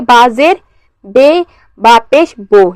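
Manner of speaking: slow "बे" with ay and "बो" with o; majhool reading. Shown as a demonstration. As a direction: open the vowels to ay in "बे" and o in "बो". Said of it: "ये हमारा मजहूल है".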